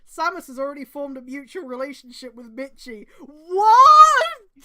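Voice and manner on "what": loud, high, stretched-out what; horrified, wailing what; shocked scream. Real, not acted. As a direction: scream it in pure disbelief. Shocked reaction to the story.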